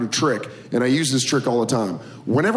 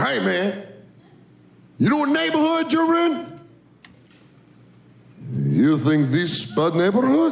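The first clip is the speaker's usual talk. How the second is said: put-on thick Russian accent, menacing; 9 semitones higher than usual. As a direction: play a Russian tough guy. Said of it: "fake a Russian accent".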